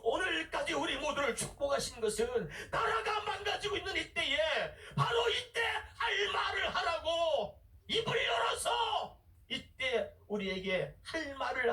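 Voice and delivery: full-force preaching, pitched high and sustained. Acted. Preacher summoning God's servants to speak up, fervent preaching. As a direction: call out to the congregation like a herald.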